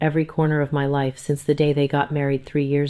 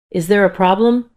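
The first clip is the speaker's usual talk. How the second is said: worried question, higher and louder; mildly trembling; 4 semitones higher, 6 dB louder than usual. A narrator's reading. Mother asking the nurse if something is wrong, mildly trembling. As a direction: ask with a touch of nervous urgency. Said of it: "my voice trembling".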